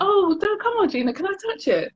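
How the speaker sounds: high, coaxing mock plea; wheedling colleague. Coworker pleading to touch her hair, high wheedling. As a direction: beg like a pushy coworker.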